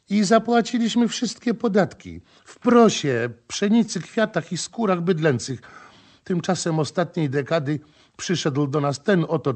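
wailing complaint. Acted. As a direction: cry out their misery. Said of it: "jęczała". (zawodząca skarga żony)

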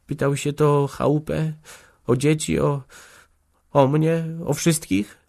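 high, halting question; timidly anxious. A narrator's reading. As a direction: edge toward the question with nervous pauses. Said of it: "zaczęła trwożnie".

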